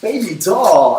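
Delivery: lightly imitated New York charmer; New York accent. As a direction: toss off "Baby doll" like a New Yorker. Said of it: "he talks with a thick New York accent".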